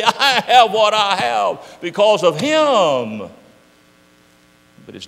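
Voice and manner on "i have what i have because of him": slow testimony. Acted. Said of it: "and say".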